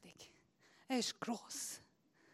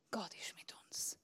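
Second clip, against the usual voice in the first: soft unvoiced whisper; 8 semitones lower, 16 dB quieter than usual. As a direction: breathe it like a secret. Whispered, then repeated aloud. (geflüstert)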